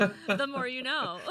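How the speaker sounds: slow, loud, laughing quip; laughing catchphrase. Real, not acted. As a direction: drawl it out through a laugh.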